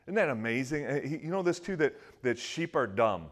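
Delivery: ordinary delivery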